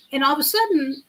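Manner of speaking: usual speaking voice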